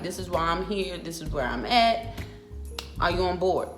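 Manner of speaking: ordinary delivery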